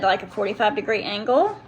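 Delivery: normal talking voice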